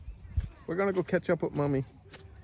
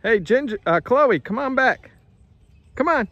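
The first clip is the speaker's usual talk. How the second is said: high, loud call to the horses; 9 semitones higher, 7 dB louder than usual. Real, not acted. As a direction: call them back from across the field. Calling horses back across field.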